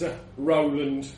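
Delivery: ordinary delivery, nothing marked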